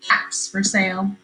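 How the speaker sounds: ordinary talk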